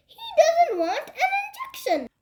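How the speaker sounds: high-pitched, exasperated complaint; exasperated report. A narrator's reading. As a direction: complain to the doctor about a difficult patient. Exasperated nurse reports Henry to doctor.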